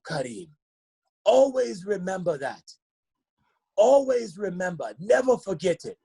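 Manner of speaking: put-on high, measured, emphatic Nigerian accent; Mister Appa. Teammate's father urging a player to remember, Nigerian accent. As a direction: hammer each phrase in a Nigerian accent. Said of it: "I'm gonna do the little accent".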